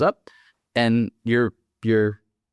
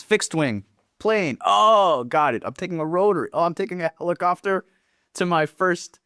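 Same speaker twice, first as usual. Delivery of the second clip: higher-pitched retold eager discovery; excited realization; 7 semitones higher than usual. New soldier working out what rotary means, excited. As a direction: act out the penny dropping, pitch jumping up.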